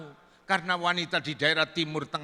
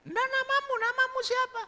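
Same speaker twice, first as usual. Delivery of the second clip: squeaky-high falsetto questioning; woman's voice. Acted. Woman asking the visitor's name, high falsetto.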